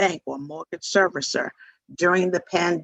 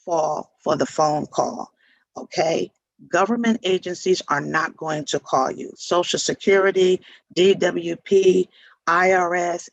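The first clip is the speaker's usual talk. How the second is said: ordinary talk, nothing marked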